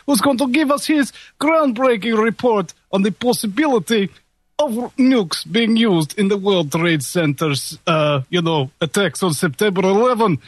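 put-on radio intro in a Russian accent. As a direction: announce the show in a Russian accent. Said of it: "that was my Russian accent".

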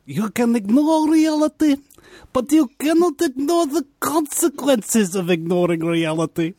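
high, put-on Russian accent; Ayn Rand's Russian accent. Host quoting Ayn Rand in her Russian accent. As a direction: quote it in a mock Russian accent. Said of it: "I prefer to do it in her accent".

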